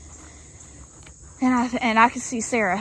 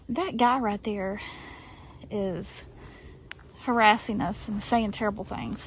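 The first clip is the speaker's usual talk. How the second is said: talking real low; 7 dB quieter than usual. Retold or imitated. Telling the waitress about the harasser, quietly. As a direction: keep it down so only the waitress hears. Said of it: "I just talked real low".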